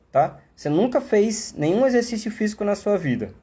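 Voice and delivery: usual speaking voice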